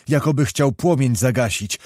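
ordinary delivery

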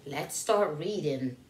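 ordinary delivery, nothing marked

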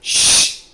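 long, forceful shush. Acted. Devil and crowd silencing the one praying, shushing. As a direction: hush someone with a sharp hiss of air.

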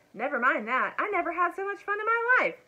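high, loud, exhilarated burst; thrilled. A narrator's reading. Elmer tells the dragon what fun it was, thrilled. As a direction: build to a delighted high exclamation.

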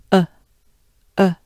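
"a" with the jaw forward and a small opening; small-mouth vowel. Shown as a demonstration. Teacher showing the vowel shape. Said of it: "small opening of your mouth".

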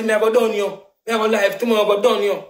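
put-on high, heated threat from the girl; imitating her angry threat; mock angry threat. Girl on the live threatening him, mock angry. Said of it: "The girl get mad and say".

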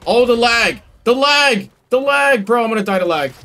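high, loud panicked yelling; panicking gamer. Real, not acted. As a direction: panic as the lag kills you. Lag hits mid-fight.

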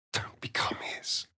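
soft, toneless urgent whisper; whispered warning. Acted. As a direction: warn them under your breath.

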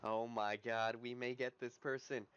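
ordinary delivery

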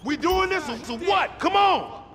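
rising yell, pushing the crew to act; urgent shout. Acted. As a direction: shout it at the crew to get moving. Gang standoff turning violent.

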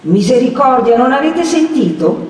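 high, loud agitated exclamation; alarmed outcry. A narrator's reading. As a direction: protest in alarm while pulling free. Perpetua alarmed, struggling free.

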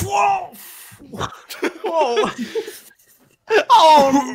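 very high, laughing whoas of wonder; amazed whoas. Acted. Passenger floating on the moon with delighted surprise. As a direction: marvel while floating up.

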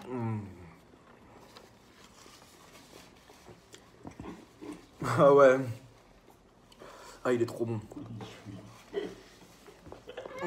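talking while chewing burger; chewing with mouth full. Real, not acted. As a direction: food in mouth. Seen 0: Burger bitten at mouth, jaw chewing throughout the labelled words.

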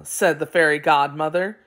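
usual speaking voice

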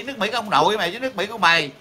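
fast, high tirade; real indignant rant at the audience; angry scolding. Real, not acted. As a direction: tear into them, rushing the words up high. Scolding Vietnamese who talk about America.

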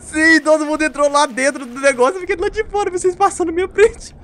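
gloating excitedly. Real, not acted. Gloating high voice.

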